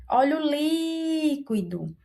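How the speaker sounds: "líquido" with a long, drawn-out, high "lí"; stressed first syllable. Shown as a demonstration. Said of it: "O li é a nossa sílaba mais forte".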